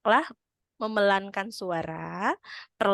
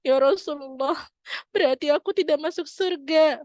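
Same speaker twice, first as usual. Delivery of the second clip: mildly tearful grandma; 6 semitones higher than usual. Retold or imitated. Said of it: "nenek itu menangis".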